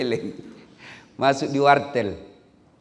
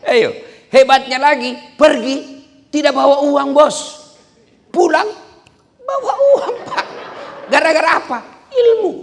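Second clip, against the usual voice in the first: much higher, much louder exhortation; full-force preaching; 14 semitones higher, 10 dB louder than usual. Acted. Preacher rouses the audience about knowledge. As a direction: drive it home to the crowd.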